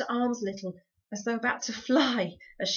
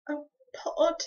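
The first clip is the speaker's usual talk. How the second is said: higher, husky plea; husky pleading; 8 semitones higher, 5 dB louder than usual. A narrator's reading. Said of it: "pleaded Homily in a husky whisper".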